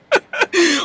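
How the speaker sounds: high burst of laughter; real high-pitched laugh. Real, not acted. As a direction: crack up at your own joke. Cracks up at her own line.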